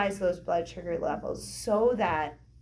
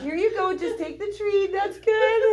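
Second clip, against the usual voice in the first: much higher, louder sing-song coaxing; coaxing a dog; 11 semitones higher, 5 dB louder than usual. Pretend. Feeding the hungry wife like a dog, a high coaxing coo.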